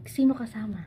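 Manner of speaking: breathy whisper; whispering while hiding. Real, not acted. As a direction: whisper so no one hears. Whispered secret plan. (pabulong, nagtatago)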